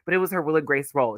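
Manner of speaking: ordinary talk, nothing marked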